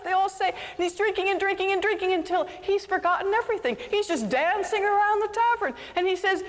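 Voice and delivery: storyteller caught up in the tavern scene, excited; lively story narration. A narrator's reading. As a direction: carry the listeners into the party.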